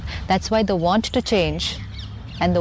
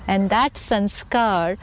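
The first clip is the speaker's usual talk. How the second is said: ordinary delivery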